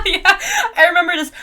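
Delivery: real laugh breaking into words. Real, not acted. Booktuber laughing while recalling the plot. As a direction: laugh through the line.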